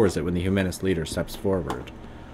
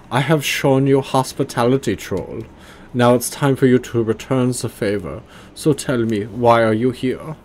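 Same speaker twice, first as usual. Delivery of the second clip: hammed-up bad German accent, higher and louder; awful German accent; 5 semitones higher, 5 dB louder than usual. Leader Stahl interrogates the troll in an awful German accent. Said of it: "I'm just giving him a awful German accent".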